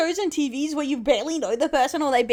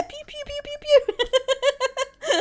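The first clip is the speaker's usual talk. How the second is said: mock gun noises; 13 semitones higher than usual.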